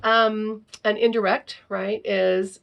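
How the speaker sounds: ordinary talk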